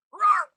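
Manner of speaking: loud high frustrated roar; rage roar. Real, not acted. Streamer roaring in rage at the dub. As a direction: let out a huge roar of frustration.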